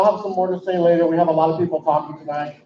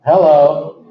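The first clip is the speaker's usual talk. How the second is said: impression of a caller; 2 semitones lower, 6 dB louder than usual. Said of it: "love the impression of the hello".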